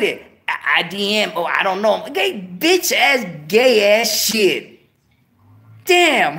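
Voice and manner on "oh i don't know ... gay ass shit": ordinary talk, nothing marked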